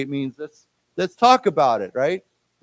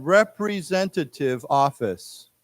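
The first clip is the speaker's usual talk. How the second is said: slow, syllable by syllable, with long pauses; slow, clear teacher reading, phrase by phrase; slow read-along; slower than usual. Shown as a demonstration. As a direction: read it out slowly for the class to repeat. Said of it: "let's say this again".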